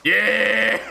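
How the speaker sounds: high, loud, drawn-out cheer; triumphant shout. Real, not acted. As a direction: whoop it out in triumph. Cheering the branch he found.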